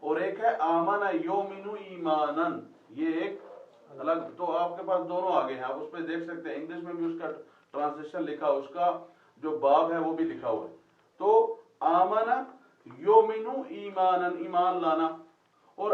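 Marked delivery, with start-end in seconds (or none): 0.6-2.6 "Amna योमिनु ईमानन" drawn out slowly, each syllable given; slow Quranic teacher's reading; slow Arabic recitation. Shown as a demonstration. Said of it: "तो Amina में देखिए दो चीजें". 11.8-14.4 "आमना योमिनु ईमानन" slow and a bit louder; slow, emphatic teacher's recitation; slow Arabic recitation. Shown as a demonstration. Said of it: "तो Amina में देखिए दो चीजें".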